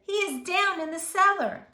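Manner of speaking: put-on high, loud urgent boy's voice; eager child calling for help; excited boy. A narrator's reading. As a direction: blurt it out to the policeman. Boy tells policeman urgently.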